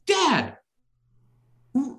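ordinary talk

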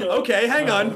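normal talking voice

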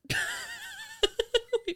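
very high squealing laugh. Real, not acted. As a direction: burst into a squeal. Confession laugh.

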